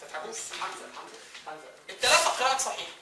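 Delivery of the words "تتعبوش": usual speaking voice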